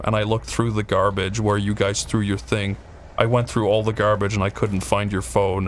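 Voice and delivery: low, subdued imitated guard; retold guard reporting back. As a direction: imitate an earnest guard on the phone. Retold guard reporting his fruitless search.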